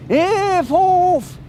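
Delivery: high mock scream; retold scream. Witness imitating the neighbour screaming at her door, high mock scream. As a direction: belt it out like the woman at the door. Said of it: "she was screaming".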